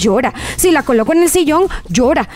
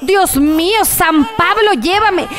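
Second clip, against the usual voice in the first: hammed-up plea to the saints, higher; mock despair; 5 semitones higher, slower than usual. Mother pretending to despair over the crying baby. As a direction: throw your hands up to heaven in jest.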